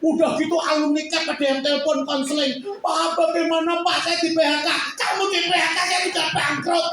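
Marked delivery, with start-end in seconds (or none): 2.8-4.7 mock worried caller. Alumnus phones for counseling. 4.9-6.9 exasperated retort. Retold or imitated. His retort to the caller.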